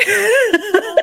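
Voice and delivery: a laugh that breaks into crying; tearful laugh. Real, not acted. Laughing through tears while telling a friend about the cancellation.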